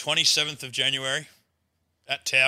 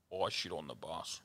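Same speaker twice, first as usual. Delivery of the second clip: low, much softer muffled voice, slow; muffled confession; 9 semitones lower, 17 dB quieter, slower than usual. Retold or imitated. Anonymous mate on a private number, muffled low confession. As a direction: mumble it like you're hiding.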